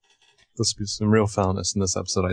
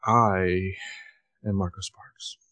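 bored deadpan sigh; 2 semitones lower, 4 dB quieter, slower than usual. Acted. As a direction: mutter your name like it's a chore. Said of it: "With, like, a big sigh and".

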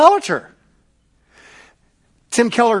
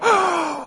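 mock shocked gasp; 11 semitones higher than usual. God mockingly imagined as shocked.

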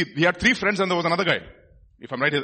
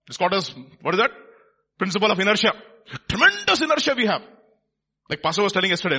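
loud, emphatic sermon delivery; forceful preaching; 3 semitones higher, 6 dB louder than usual. Real, not acted. Preacher likening stubborn hearts to inertia, forceful. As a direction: drive the point home loudly.

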